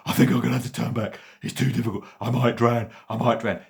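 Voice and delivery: loud, high, fast panic; frightened dog. A narrator's reading. Dog struggling in the currents, loud and panicked. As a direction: gasp it out, scared of drowning.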